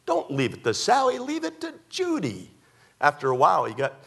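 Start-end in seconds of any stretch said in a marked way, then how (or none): 0.0-2.5 lightly voiced, higher insistent wife; mock pushy wife pleading her pick. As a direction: push for Judy, rising higher. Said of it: "And she said".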